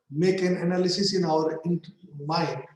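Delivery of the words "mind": usual speaking voice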